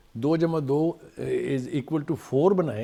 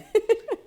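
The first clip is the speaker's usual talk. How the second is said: high burst of laughter; amused laugh; 3 dB louder than usual. Real, not acted. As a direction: laugh mid-answer. Laughs amid his own answer. (اونچی ہنسی)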